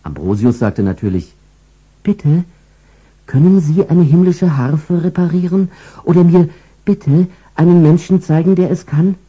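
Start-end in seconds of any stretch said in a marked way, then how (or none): 2.0-9.2 put-on high childlike angel voice, asking politely; a little angel pleading sweetly; small angel.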